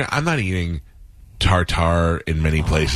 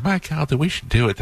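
sweet Southern twang, pitched up; co-worker flirting in a Southern twang; 9 semitones higher than usual. Retold or imitated. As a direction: coo it in a Southern twang like a churchgoing wife. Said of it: "she had that twang to her voice".

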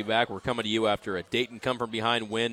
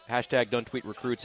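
usual speaking voice

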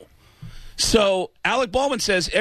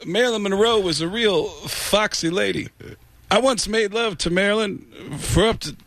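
[name] impression; 3 semitones higher than usual. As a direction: do a crooner reminiscing with little pauses. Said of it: "[name] impression".

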